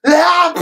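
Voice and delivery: loud high shouted sneeze, "Ah!"; yell sneeze. Retold or imitated. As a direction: let the sneeze rip as a shout. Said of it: "yell sneezed".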